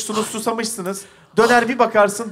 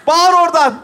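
higher, raised command; shouted order; 5 semitones higher than usual. Real, not acted. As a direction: order it from across the stage. Director orders actor to shout.

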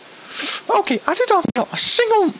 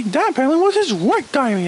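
usual speaking voice

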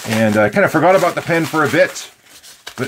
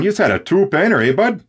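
put-on Prairie Canadian accent; 6 semitones higher, faster than usual. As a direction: play a prairie buddy, quick and high. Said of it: "If you're from Alberta, Saskatchewan".

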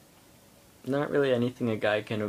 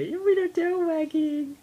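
dog voice. Real, not acted. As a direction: coo at the puppy up high. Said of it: "That's my dog voice".

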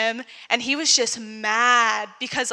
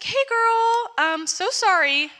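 high, slow sing-song sympathy; high sympathetic greeting; 10 semitones higher, slower than usual. Retold or imitated. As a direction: soothe her, pitched up. Friend sympathizing.

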